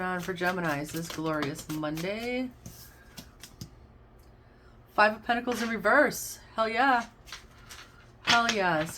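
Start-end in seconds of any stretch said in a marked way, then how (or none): 5.0-9.0 higher and louder, delighted card call; thrilled. Real, not acted. Tarot reader cheers for Gemini over a reversed card, excited.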